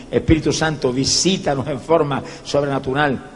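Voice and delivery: low, hushed pastoral prayer; reverent, measured supplication; solemn prayer. Real, not acted. Leads a closing prayer.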